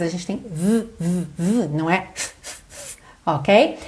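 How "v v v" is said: buzzing voiced "v"-like TH held briefly. Shown as a demonstration. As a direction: hum the TH as a low buzz, like "v". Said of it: "são vocalizados". (som de TH vocalizado, "v")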